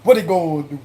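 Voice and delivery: run-together Cajun "What they gonna do"; Cajun-accented question. Shown as a demonstration. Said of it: "How I say like that?".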